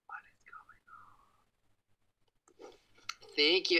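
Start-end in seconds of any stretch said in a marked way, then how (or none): 0.1-2.7 hushed whisper. Pretend. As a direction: breathe it out barely voiced. Whispering with voice filter.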